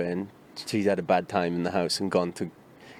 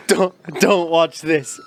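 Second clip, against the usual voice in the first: slow, high and loud choked-up plea; tearful warning; 8 semitones higher, 6 dB louder, slower than usual. Retold or imitated. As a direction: beg your mate through tears not to watch. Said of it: "Turn to him and go".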